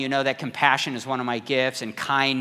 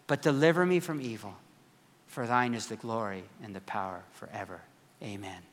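quiet prayer recital; 4 semitones lower, 8 dB quieter than usual. Retold or imitated.

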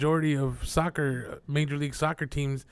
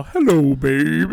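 louder, breathy doll greeting voice; creepy doll voice; 9 dB louder, slower than usual. Acted. Mocking the doll that greets her owner, creepy doll voice. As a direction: coo it like a haunted doll.

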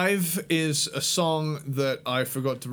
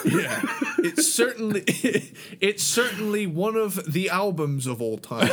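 laughing; 6 semitones higher than usual. Real, not acted. Laughing mock praise of album.

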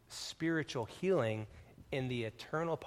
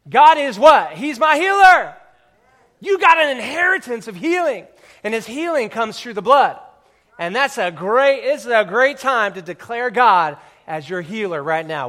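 loud, high fired-up preaching; 9 semitones higher, 18 dB louder than usual. Acted. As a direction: preach it out with full force. Preaching at full force.

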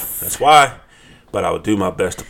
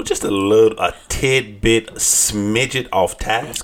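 slow drawn-out; 3 semitones higher, much slower than usual. Acted. Drawing out an understatement.

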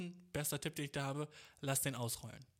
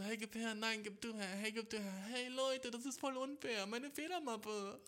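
much higher, hammed-up pleading kid; mock playground whine, pitched way up; whining schoolkid; 8 semitones higher than usual. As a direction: beg like a teased child. Schoolkid in keep-away game.

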